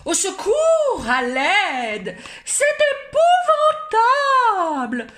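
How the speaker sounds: high, loud cries for help; panicked screaming. Acted. Visitors screaming for help in panic. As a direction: scream in horror at the sight. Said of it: "hurlèrent les visiteurs".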